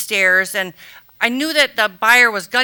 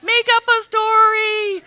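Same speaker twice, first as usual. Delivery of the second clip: pleading; 11 semitones higher, slower than usual. Retold or imitated. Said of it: "I'm like".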